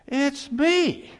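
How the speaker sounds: sudden high, loud punch; emphatic answer. Real, not acted. Pastor answering his own question, loud and emphatic. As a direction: land the answer hard.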